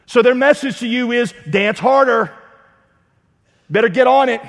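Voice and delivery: high, loud preacher's build; preaching at full force. Acted. Preacher warning congregation about false gods, full force. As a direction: drive it home like a revival preacher.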